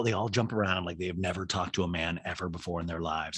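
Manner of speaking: ordinary delivery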